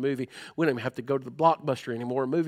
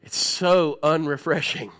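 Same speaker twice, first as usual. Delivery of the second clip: slow emphatic aside; 4 dB louder, slower than usual. Real, not acted. Joke about bad breath up close.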